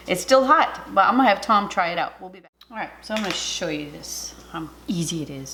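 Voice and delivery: ordinary talk, nothing marked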